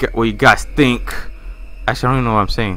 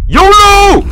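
battle cry; 11 dB louder than usual. Real, not acted. Gamer charging into danger with a wild yolo yell. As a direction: yell it very loud and high.

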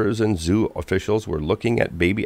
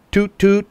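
whistle toot; 13 semitones higher, 5 dB louder than usual. A narrator's reading. Train whistle imitation.

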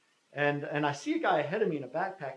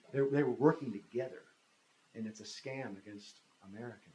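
ordinary delivery

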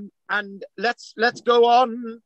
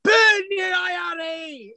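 slow, high shouted Ulster roar; mock Paisley shout; 9 semitones higher, slower than usual. Host imitating Ian Paisley shouting, hammed-up roar. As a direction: bellow it like a firebrand preacher. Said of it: "If he said".

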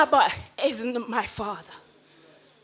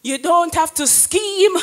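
ordinary talk

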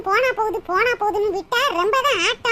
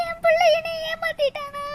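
mother lamenting her son's betrayal, sobbing; crying lament; 4 semitones higher than usual. Acted. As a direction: wail it out through tears. Mother learns her son deceived her.

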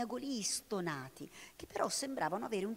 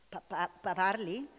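stammering shock; 6 dB louder, slower than usual. A narrator's reading. Man stunned that the cat speaks, stammering in shock. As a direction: stammer in disbelief at a talking cat. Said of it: "esclamò l'umano".

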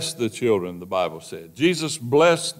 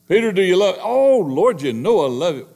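higher, louder pleading; urgent plea; 6 semitones higher, 4 dB louder than usual. Acted. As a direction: protest eagerly, voice lifted. Peter's urgent higher answer.

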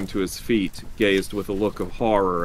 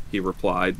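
ordinary delivery

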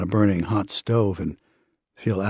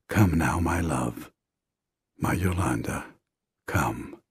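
low, soft and intimate; hushed loving invitation with lingering pauses; tender call; 6 semitones lower, 6 dB quieter than usual. Retold or imitated. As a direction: sink deep and quiet on each phrase. Abba's loving call, repeated more intimately.

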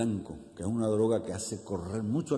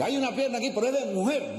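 high-pitched caller's announcement; 電話の呼びかけ役; 12 semitones higher than usual. Acted. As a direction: call out the news, pitched well up. Caller in a retold joke.

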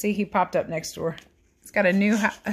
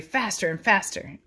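usual speaking voice

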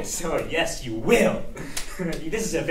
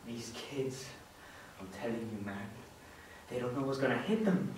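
low, very soft villainous musing; hushed, low self-talk with menace; gloating soliloquy; 6 semitones lower, 12 dB quieter than usual. Acted. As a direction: mutter the scheme to yourself. Villain gloats alone, plotting against kids.

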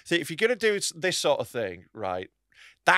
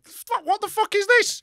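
high, drawn-out mock disbelief; heckler in the corner; slower than usual. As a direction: pitch it up, slowly, like an appalled onlooker. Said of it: "the one in the corner going".

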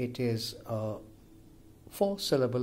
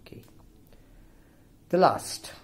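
usual speaking voice

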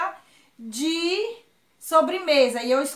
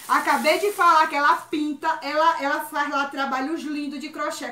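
ordinary delivery

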